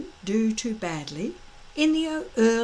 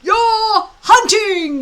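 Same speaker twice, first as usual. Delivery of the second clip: slow booming yell, much higher; 11 semitones higher, 11 dB louder, much slower than usual. A narrator's reading. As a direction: bellow it out slowly. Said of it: "yelled Gobber the Belch".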